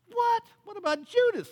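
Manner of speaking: very high, startled protest; baffled, pitch shooting up; incredulous questioning. Acted. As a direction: blurt it out in disbelief. Imagined frank prayer of Jesus.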